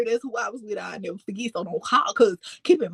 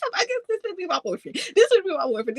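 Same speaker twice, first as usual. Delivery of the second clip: giddy, higher, fast gush; retold excitement; 8 semitones higher, faster than usual. Girl gushing about her crush, giddy. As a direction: gush over the new boyfriend. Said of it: "I'm excited as fuck".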